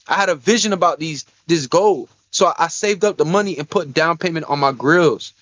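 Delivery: ordinary talk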